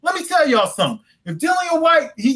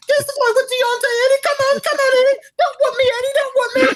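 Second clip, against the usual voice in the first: Mister Bill pleading; faster than usual. Pretend. [name] begging Eddie for a fight, in a high wailing cry. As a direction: beg like a squealing cartoon victim. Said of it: "you sound like Mister Bill on Saturday Night Live".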